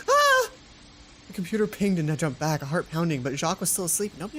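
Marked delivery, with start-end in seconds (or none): 0.1-0.5 sudden high yelp; short shriek of fright; startled yelp. Real, not acted. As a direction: jump at the noise with a sharp cry. Startled by computer ping.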